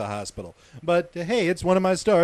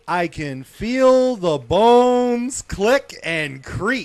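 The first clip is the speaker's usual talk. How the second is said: slow, louder, higher gross detail; slow dramatic; 5 semitones higher, 6 dB louder, much slower than usual. Acted. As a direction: savor the gruesome part.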